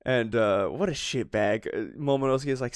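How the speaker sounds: ordinary delivery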